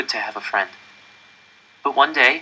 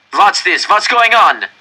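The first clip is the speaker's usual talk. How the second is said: loud, high angry demand; a guard bursting in, furious; 10 semitones higher, 7 dB louder, slower than usual. A narrator's reading. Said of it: "an angry voice demanded".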